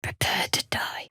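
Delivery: hushed menacing whisper; whispered threat. Acted. Enemy threatening the party, menacing whisper. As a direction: breathe the threat.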